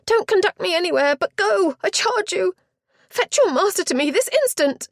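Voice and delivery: high, loud commanding cry; a distraught lady snapping orders to the butler, high and loud; imperious frantic duenna. Acted. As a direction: order the servant off in a panic.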